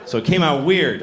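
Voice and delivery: ordinary talk, nothing marked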